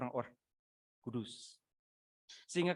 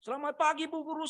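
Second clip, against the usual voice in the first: mock schoolboy shout; 13 semitones higher than usual. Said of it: "paling kencang supaya guru perhatikan".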